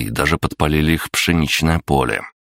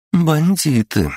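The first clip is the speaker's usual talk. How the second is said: cool female voice; 13 semitones higher, much slower than usual. A narrator's reading. Vera's female voice.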